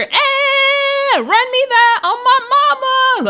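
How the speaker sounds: very high, sustained mock holler; Summer. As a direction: imitate a loud castmate screeching over everyone. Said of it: "Summer is so loud".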